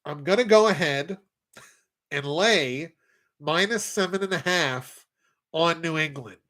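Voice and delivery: nasal voice with nose pinched shut; nose plugged. Acted. Picking the Patriots against the spread, said holding his nose. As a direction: talk holding your nose closed. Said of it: "That's my actual nasally voice when I plug my nose".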